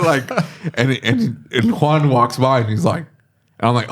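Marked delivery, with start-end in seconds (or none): none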